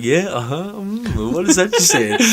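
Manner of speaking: too-nice customer voice; being polite to a stranger in new job mode; mock friendly. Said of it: "friendly new job mode".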